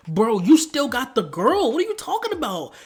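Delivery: loud disbelief climbing high; incredulous scolding. Acted. Vlogger calling out the killer's excuse, high incredulous protest. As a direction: protest it, voice shooting up at the end.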